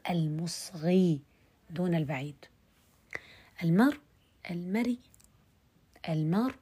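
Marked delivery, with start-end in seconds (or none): 4.5-5.0 المري with a faint, barely voiced kasra at the end; rawm on المري. Shown as a demonstration. Teacher demonstrating rawm, المري ends in a faint kasra. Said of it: "رومها صوت منخفض".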